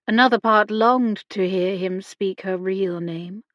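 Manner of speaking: usual speaking voice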